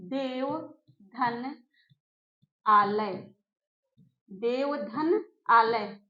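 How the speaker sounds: देव धन आलय spoken slowly, each part separate; split into syllables. Shown as a demonstration. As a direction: break देव धन आलय apart slowly for the class. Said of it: "आप समझिए, आप बोलिए".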